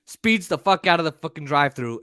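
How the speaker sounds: usual speaking voice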